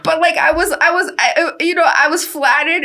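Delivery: ordinary talk, nothing marked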